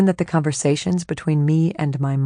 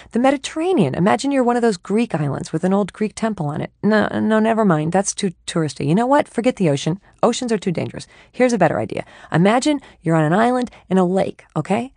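rambling chatty mind; 4 semitones higher than usual. Acted. Her mind rambling about islands, eager and chatty. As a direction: babble on eagerly.

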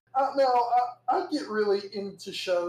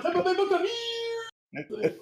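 Tampa Bay Buccaneers called out much higher and slow; mock stadium announcer; 13 semitones higher, slower than usual. Mocking Florida sports fans with a high, slow stadium call. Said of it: "We're like".

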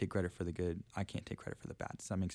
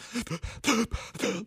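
high, loud mock sobbing gasps; imitated sobbing gasps; 5 dB louder than usual. Showing how hard the crying was, imitated sobbing gasps. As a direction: gasp as if crying too hard to breathe. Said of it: "crying where you're like".

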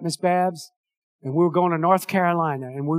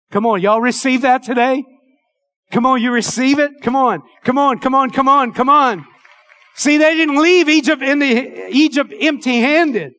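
higher, louder call to the crowd; rousing exhortation; 5 semitones higher, 4 dB louder than usual. Acted. Pastor urging the congregation to receive it, rousing. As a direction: rouse them to answer back.

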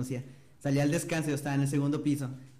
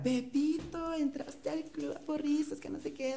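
high-pitched excited cheer, much higher, retold; imitating excited yell; 13 semitones higher, 5 dB quieter, slower than usual. Friend calls out to him at cheer practice, high excited yell. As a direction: call out his nickname in a high excited voice, slower. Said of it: "me ve ella y me grita".

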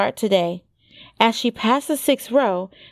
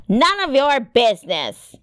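gruff, higher-pitched rebuff; 4 semitones higher than usual. A narrator's reading. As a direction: shut down the question gruffly. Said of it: "came the gruff voice".

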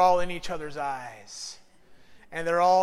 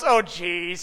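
wife unimpressed; 5 dB louder than usual. Retold or imitated. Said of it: "my wife gets them and goes".

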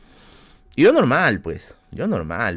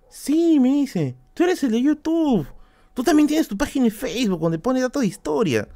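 impersonating the stranger; 13 semitones higher than usual. Retold or imitated. Stranger's words acted out.

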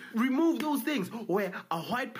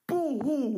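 mock crying; 7 semitones higher, 4 dB louder than usual. Mocking crying.

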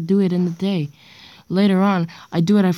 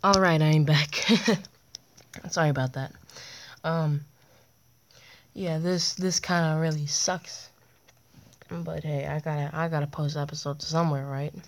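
quiet; 8 dB quieter than usual. Real, not acted. Late-night talk after water break.

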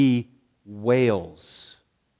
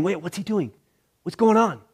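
puzzled crowd; 5 semitones higher, faster than usual. Retold or imitated. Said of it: "you can hear the people saying".

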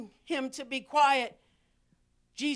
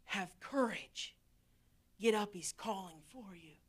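changed soft voice; 8 semitones lower, 12 dB quieter than usual. Retold or imitated. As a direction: hush it gently to the blind man. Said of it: "they changed their voice".

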